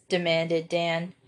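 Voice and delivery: plain reading voice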